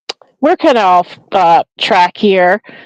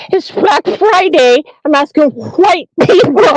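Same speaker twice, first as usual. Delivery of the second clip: higher, mocking talk-show host voice; mimicking the host; 7 semitones higher than usual. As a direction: parrot the host back, mockingly. Repeating the clip's host.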